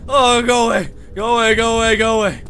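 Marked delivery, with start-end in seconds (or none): none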